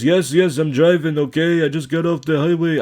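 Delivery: loud, high mock Russian accent; imitated Russian accent. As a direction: mimic an irritated driver with a Russian accent. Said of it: "Sounds like a Russian guy".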